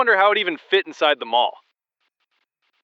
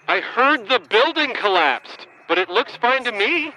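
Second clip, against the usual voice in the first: much higher and louder, slow, voice straining; pleading in rising panic, trapped and desperate; frantic high-pitched distress building to a cry; panicked; 13 semitones higher, 4 dB louder, slower than usual. Acted.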